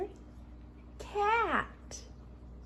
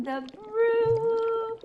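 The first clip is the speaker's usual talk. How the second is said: high loud brew; 9 semitones higher, 6 dB louder than usual. Pretend. Witch brew chant climax.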